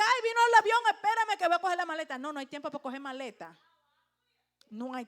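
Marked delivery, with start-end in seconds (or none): 0.0-2.2 loud, high-pitched flustered traveler; hammed-up last-minute fluster; mock panic. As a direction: cry out as if caught unprepared. Mimics unready traveler caught off guard.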